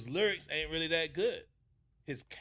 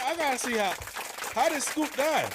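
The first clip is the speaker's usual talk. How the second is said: excited; 11 semitones higher, 6 dB louder than usual. Real, not acted. Eager to check video.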